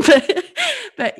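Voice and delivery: high laugh, blinking back tears; embarrassed laugh. Real, not acted. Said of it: "I get a bit teary".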